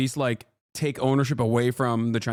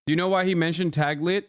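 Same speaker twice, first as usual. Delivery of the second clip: ordinary talk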